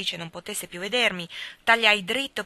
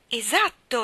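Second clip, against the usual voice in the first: higher, louder triumphant confirmation; exclaiming; 5 semitones higher, 5 dB louder, slower than usual. A narrator's reading. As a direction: exclaim it with delight. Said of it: "esclamò". (esclamazione entusiasta)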